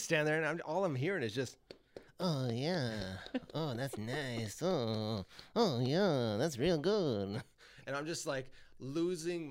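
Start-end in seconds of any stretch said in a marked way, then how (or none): 2.2-2.9 drawn-out moan of approval; creepy dwarf moaning. Acted. Imitating dwarf heard outside the door. 3.5-7.4 slow, drawn-out moans of approval; creepy dwarf moaning. Acted. Imitating dwarf heard outside the door.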